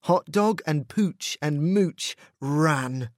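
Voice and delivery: ordinary delivery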